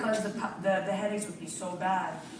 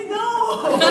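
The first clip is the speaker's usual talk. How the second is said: laughing; 14 semitones higher, 11 dB louder than usual. Real, not acted. Crowd knows the syndrome.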